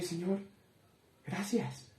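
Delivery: soft, hushed "Señor, gracias"; quiet prayer. Retold or imitated. Alone in his room, thanking God in a soft prayer. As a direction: murmur a thank-you prayer.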